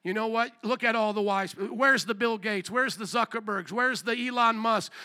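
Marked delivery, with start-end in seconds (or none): none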